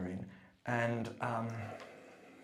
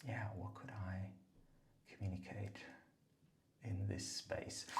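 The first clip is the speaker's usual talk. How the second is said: soft hushed musing; whispering; 9 dB quieter than usual. Real, not acted. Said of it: "hushed musing".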